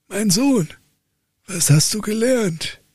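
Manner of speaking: high, exasperated father voice; old count lecturing his dull son; stern old count. Acted. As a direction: play the despairing old father.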